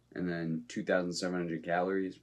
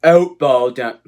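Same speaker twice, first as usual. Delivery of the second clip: put-on shouted, much higher, botched Australian accent; horrible Australian accent; 8 semitones higher, 18 dB louder, slower than usual. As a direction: yell it in a clumsy fake Australian accent. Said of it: "That is a horrible Australian accent".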